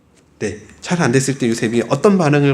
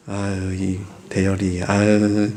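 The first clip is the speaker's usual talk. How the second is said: softer, lower, slow mock sigh; mock teasing; 6 semitones lower, 5 dB quieter, much slower than usual. Pastor teasing a bragging student, low and slow. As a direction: sigh at him in teasing disbelief.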